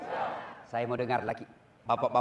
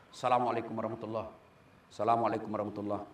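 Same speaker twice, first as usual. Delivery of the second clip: prayer salutation; 6 semitones lower, 6 dB quieter, faster than usual. Retold or imitated. Imam ending prayer, quick.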